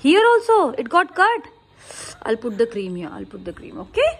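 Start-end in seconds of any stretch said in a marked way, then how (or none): 0.0-1.5 concerned surprise. Real, not acted. Mother sees another cut.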